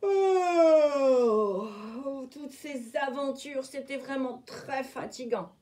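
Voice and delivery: wolf yawning, worn out. A narrator's reading. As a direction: yawn it out. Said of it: "Loup bâilla".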